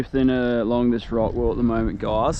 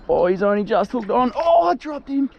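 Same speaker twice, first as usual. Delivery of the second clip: excited; 10 semitones higher than usual. Real, not acted. Squid drops off.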